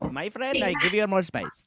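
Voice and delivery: high, lilting mock Indian accent; a broad, hammed-up Indian shopkeeper accent; bad Indian accent. To his friend, offering beer in a bad Indian accent. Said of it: "That is a bad accent".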